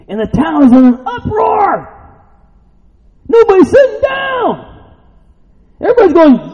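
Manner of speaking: very high, excited shout from the pulpit; shouted preaching. Real, not acted. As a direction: shout the uproar out to the congregation. Preaches at full force.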